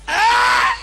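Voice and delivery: shrill hammed-up cry of joy; high mock shriek. Girlfriend squealing at the ring in mock joy.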